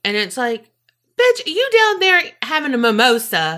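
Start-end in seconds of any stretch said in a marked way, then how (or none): 1.2-3.6 high-pitched, sassy outrage; mock indignation. Cohost voicing a retort to the fake mom, mock indignant. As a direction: call her out in a raised, incredulous voice.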